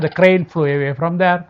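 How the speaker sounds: ordinary delivery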